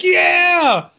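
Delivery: high, loud crowd cheer; hammed-up theater cheer; mock cheer. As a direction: whoop like a thrilled audience. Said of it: "everyone in the theater's like".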